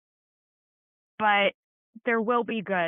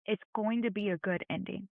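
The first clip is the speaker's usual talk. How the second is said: ordinary talk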